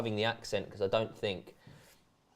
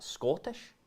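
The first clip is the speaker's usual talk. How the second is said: higher, questioning Scottish accent; unsure Scottish lilt, pitched up; 7 semitones higher, 3 dB quieter than usual. Shown as a demonstration. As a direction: test a Scottish accent on one word. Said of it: "What Scottish ac-, where is that?".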